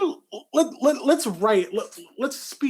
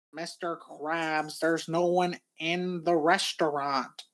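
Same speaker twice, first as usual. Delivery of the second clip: slow, soft, bored Squidward; deadpan and sluggish, like you can't be bothered; 3 dB quieter, slower than usual. Acted. Squidward answering Mr. Krabs.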